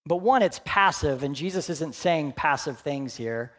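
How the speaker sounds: usual speaking voice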